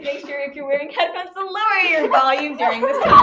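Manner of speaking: mock disclaimer rising into a laughing shout; mock warning breaking into laughter. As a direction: announce it, losing it to giggles.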